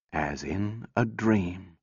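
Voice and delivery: slow, soft, dreamlike repeat; slow hushed echo. A narrator's reading. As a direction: let the words drift away quietly. Echoed slower and softer.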